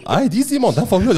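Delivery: high, laughing mock protest; mock calming. Retelling how he calmed the roommate down. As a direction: wave it off, half laughing. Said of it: "Ich so".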